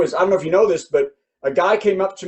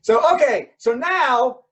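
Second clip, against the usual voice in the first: ordinary delivery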